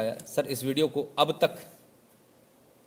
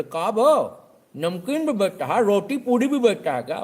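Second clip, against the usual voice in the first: much higher, louder scornful questions; mock yelling challenge; 8 semitones higher, 7 dB louder than usual. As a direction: shout the challenge at the shopkeeper. Customer challenging shopkeeper.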